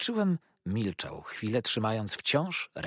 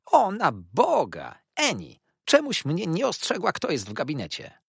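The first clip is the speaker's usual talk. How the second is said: flustered mother bursting in; agitated exclaim; 9 semitones higher, 8 dB louder than usual. A narrator's reading. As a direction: Mrs Markleham calling out in a flurry. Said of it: "wołając".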